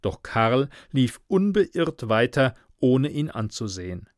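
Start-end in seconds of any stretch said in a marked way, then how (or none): none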